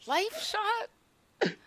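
high, incredulous question; outraged disbelief. Real, not acted. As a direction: spit out the name in disbelief. Pet got wrong skill.

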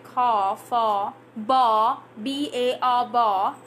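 "car", "far", "bar" each ending on an open vowel; R left silent. Shown as a demonstration. Teacher lists rhyming words for learners, "bar" without R. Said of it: "rhyming words with car".

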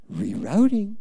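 soft, soothing navigation prompt; gentle GPS voice. Pretend. Preacher imitating the GPS guiding a lost driver, gentle. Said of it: "it gets very gentle, goes".

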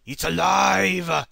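mad scientist shout, high and loud; triumphant yell, slow and drawn out; sneering panto villain. Pretend. Creation comes to life, Frankenstein-style.

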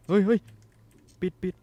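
real jump-scare shriek; terrified scream. Real, not acted. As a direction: scream to shut it now.

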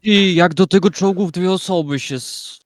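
excited. Real, not acted. Amazed at tank.

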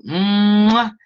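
loud, high, drawn-out "mmm-wah" kiss; big smacking kiss for the viewers; blown kiss sound. Real, not acted. Crafter signing off to her viewers, big kiss sound.

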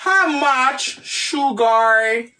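very slow "How much sugar", word by word; slow modelling. Shown as a demonstration. Teacher repeating the question for the class, slowly modelled. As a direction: dictate "How much", pause, then a drawn-out "sugar".

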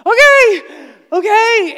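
high, loud nervous yes; flustered okay. Retold or imitated. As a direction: blurt it out, rattled but obeying. Startled answer to God's command.

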